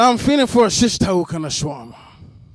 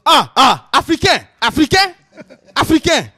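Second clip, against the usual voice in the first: loud, high imitation of a worked-up man; mock angry insistence; 7 semitones higher, 6 dB louder than usual. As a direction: jab each word at him, mock-outraged. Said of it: "the guy got mad".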